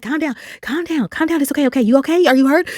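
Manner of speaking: put-on high, rushed calm down to the kids; mother calming kids, high and urgent; urgent reassurance. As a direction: soothe them while still shaken. Calming screaming kids after crash.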